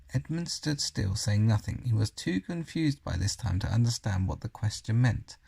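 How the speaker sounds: ordinary delivery, nothing marked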